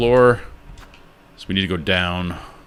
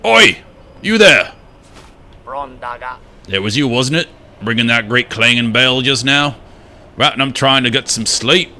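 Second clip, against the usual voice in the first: higher, louder, gruff shout of a woken grump; grumpy bell-keeper character; 5 semitones higher, 4 dB louder than usual. Acted. Woken-up grump scolding the bell ringer, higher and louder. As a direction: bark at the stranger for ringing the bell.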